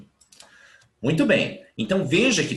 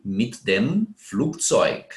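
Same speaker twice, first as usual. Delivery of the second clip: slow model phrase; much slower than usual. Shown as a demonstration. Teacher stresses article choice.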